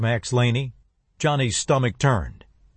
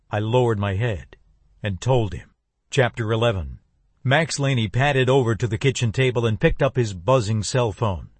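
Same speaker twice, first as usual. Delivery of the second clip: ordinary delivery, nothing marked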